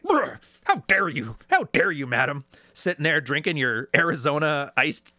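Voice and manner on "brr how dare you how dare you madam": mock indignant huff. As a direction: huff like a pompous man taking offence.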